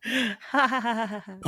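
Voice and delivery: high cackling villain laugh; unhinged villain laugh. Pretend. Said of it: "That's my evil laugh".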